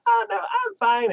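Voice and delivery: put-on high little-girl voice. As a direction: play the little girl brushing it off.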